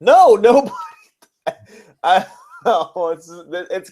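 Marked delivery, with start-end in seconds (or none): none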